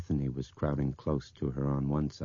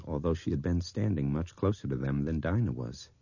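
ordinary delivery, nothing marked

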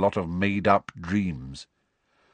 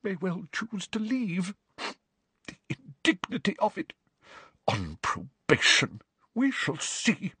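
high, much softer muttering; grumbling under the breath; muttering threats; 10 semitones higher, 6 dB quieter than usual. A narrator's reading. Trelawney striding among students, muttering softly. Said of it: "muttering what sounded like threats".

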